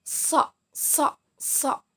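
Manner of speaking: isolated demo repeats of a heavy "So"; emphatic Arabic shad. Shown as a demonstration. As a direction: say each "So" separately, crisp and emphatic. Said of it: "menempel pada gigi seri bawah".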